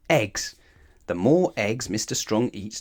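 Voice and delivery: plain reading voice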